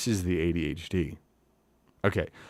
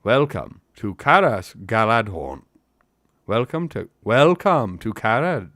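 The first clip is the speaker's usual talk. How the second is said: loud, raised character voice in a put-on accent; Haldir; 3 semitones higher, 11 dB louder, slower than usual. Elf greeting the company at the city, loud put-on accent. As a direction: declaim a formal welcome. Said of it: "how do we do Haldir again?".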